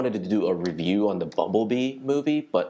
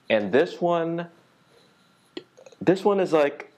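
raised voice; 8 semitones higher than usual. Real, not acted. Building to a tough subject.

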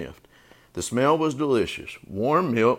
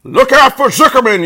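high, loud warning shout; alarmed bird holler; gander's yell; 14 semitones higher, 11 dB louder than usual. A narrator's reading. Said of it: "yelled the gander".

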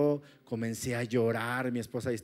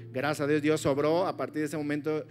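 normal talking voice

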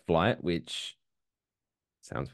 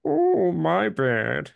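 high, drawn-out sheepish gimmick voice; Kevin James; 8 semitones higher, 3 dB louder, much slower than usual. Acted. Imitating the lawn winner's pose, high slow mock apology. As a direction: stretch it slowly, pitched up, as a hammy apology. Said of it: "doing the Kevin James gimmick where she's like".

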